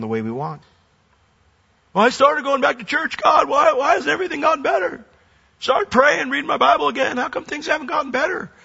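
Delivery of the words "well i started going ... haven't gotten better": much higher, whining churchgoer; mock petulant complainer, high and nagging; whiny complaint. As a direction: whine like an entitled believer.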